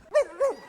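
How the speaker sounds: high-pitched dog barks; Lassie. Acted. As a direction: yelp twice like an excited dog. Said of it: "Lassie who would go".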